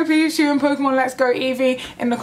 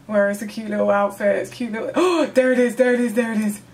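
ordinary delivery

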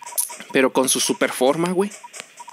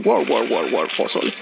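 lightly voiced alien chatter, lower; alien gibberish; 6 semitones lower than usual. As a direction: lower mock alien babble fading to a breath. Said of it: "entonces yo estoy escuchando".

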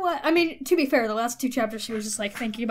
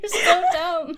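laughing hard; 4 semitones higher than usual. Real, not acted. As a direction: fight to talk through laughing fits. Both hosts cracking up.

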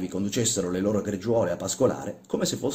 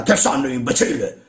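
loud, higher angry snap; interrupting shout; 5 semitones higher, 6 dB louder than usual. Acted. Old man shouting down the workman, angry. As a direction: cut him off with a shout. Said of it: "gli gridò il vecchio interrompendolo".

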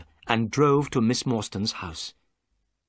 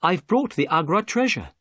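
higher, put-on happy announcement; forced cheer; 7 semitones higher, 4 dB louder than usual. Watson presenting the treasure to Miss Morstan, forced cheer. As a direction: sound cheerful, a little forced. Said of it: "trying to speak happily".

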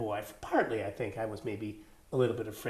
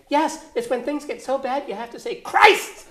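high, loud imitation of a keen child; excited kid; 12 semitones higher, 10 dB louder, slower than usual. Schoolkid answering what a crisis is, excited and shouting. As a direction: build to a high shouted last word. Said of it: "And one kid said".

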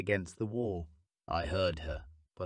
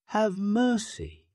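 pleading; 12 semitones higher, 3 dB louder, slower than usual. A narrator's reading. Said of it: "I repeated".

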